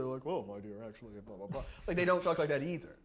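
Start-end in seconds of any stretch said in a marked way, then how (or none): 0.3-1.6 low, softer mock-refined voice; put-on character voice trailing into blahs; Thaddeus. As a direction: lower and softer, mimicking a character's speech. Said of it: "don't talk like Thaddeus either".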